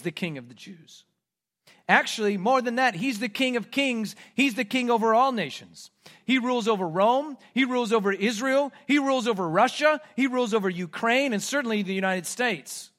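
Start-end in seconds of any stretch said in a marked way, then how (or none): 1.9-12.9 high, loud, forceful proclamation; preaching. Real, not acted. Preacher proclaiming Jesus king over all nations, preaching. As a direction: build a ringing list with force.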